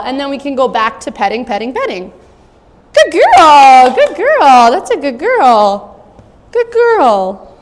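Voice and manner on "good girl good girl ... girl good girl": loud, high happy praise for the dog; excited praise. Real, not acted. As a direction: cheer her on. Praising puppy.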